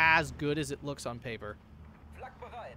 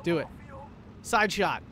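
ordinary talk

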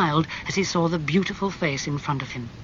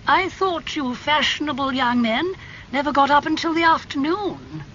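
put-on higher, surprised old aunt; Lady Agatha voice; 11 semitones higher than usual. A narrator's reading. Aunt greeting her nephew, high and surprised. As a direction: tease him with mock surprise.

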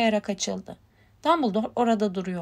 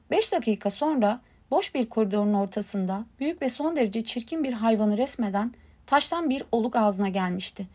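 ordinary delivery

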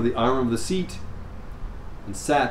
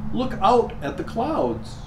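mother instructing; 9 semitones higher, slower than usual. Acted. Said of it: "the mother instructed her".

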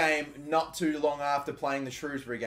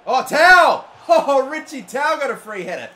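loud, high cry that breaks into a laugh; excited yell; 10 semitones higher, 13 dB louder than usual. Real, not acted. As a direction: shout as the chance comes. Free header chance in game.

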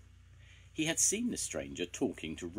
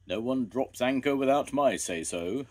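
loud, higher, self-important official; harbour master agreeing; 6 semitones higher, 7 dB louder than usual. A narrator's reading. Harbour master boasting to a girl, proudly agreeing. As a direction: assert authority in a raised voice. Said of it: "agreed the harbour master".

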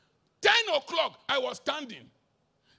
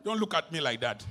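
ordinary talk

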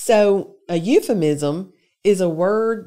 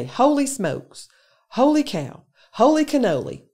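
mock exclamations; 7 semitones higher, 3 dB louder than usual. Teacher listing exclamations people say, with higher mock surprise. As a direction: toss out each one like a startled bystander.

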